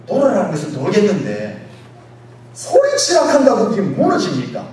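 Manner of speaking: loud, rising disbelief; acted incredulous protest. Soldier doubting that shouting topples walls, acted incredulous protest. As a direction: play the doubter scoffing at the plan. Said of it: "이렇게 말하지 않겠어요".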